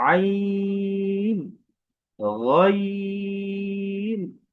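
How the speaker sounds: আইন and গইন sung long from the throat; long drawn letter names. Shown as a demonstration. Teacher reviewing letters for class, drawn out. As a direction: stretch each letter name slowly. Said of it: "দুইটি হরফ শিখেছি".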